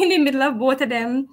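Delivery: ordinary talk, nothing marked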